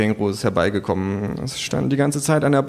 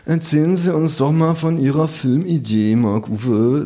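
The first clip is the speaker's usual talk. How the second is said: host voice; 5 semitones higher than usual. Acted. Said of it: "sagt der Gastgeber".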